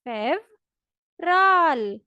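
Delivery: फेवराल very slow, with v not b; Russian fevral. Shown as a demonstration. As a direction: stretch फेवराल out clearly. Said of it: "दोबारा मेरे साथ बोलें". (फेवराल को बहुत धीरे, साफ़ बोलें)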